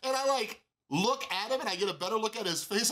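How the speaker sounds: ordinary talk, nothing marked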